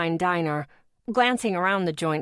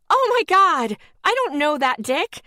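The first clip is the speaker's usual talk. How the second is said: much higher, loud shocked shriek; 9 semitones higher, 7 dB louder than usual. Retold or imitated. Woman shrieking at a stranger caught in the booth. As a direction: scream at the sight of a stranger. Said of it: "I shrieked".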